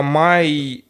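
ordinary talk

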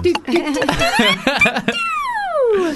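shrieking laugh; high squealing laugh. Real, not acted. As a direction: burst into a high giggle. Reacting to weird spinning sound.

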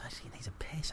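hushed retold aside; unvoiced whisper of a quote. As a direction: whisper it like a secret.

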